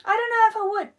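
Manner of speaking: ordinary delivery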